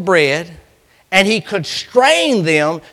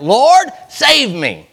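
slow, higher, louder plea; cry for help; 6 semitones higher, 5 dB louder, much slower than usual. Acted. Sinking Peter to the Lord, loud slow plea. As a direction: cry out slowly for rescue.

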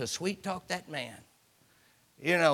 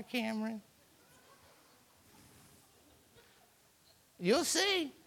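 high, soft cooing voice; sweet talk; 9 semitones higher, 5 dB quieter than usual. Retold or imitated. Girl sweet-talking a boy, high soft coo.

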